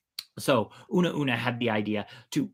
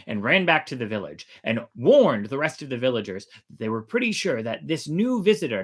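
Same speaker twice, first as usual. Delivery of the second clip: ordinary delivery, nothing marked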